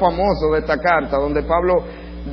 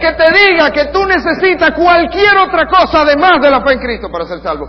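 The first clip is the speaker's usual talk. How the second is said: furious curse; 11 semitones higher, 7 dB louder than usual. Retold or imitated. Paul cursing false teachers, shouted loud and high. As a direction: hurl the word maldito at them.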